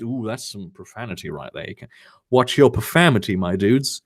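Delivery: plain reading voice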